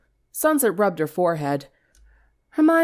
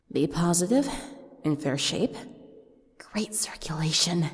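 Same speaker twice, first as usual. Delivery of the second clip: softer, trailing into a whisper; hushed murmur; 6 dB quieter than usual. Acted. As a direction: murmur it to yourself, hungrily. Sunset's hungry inner musing.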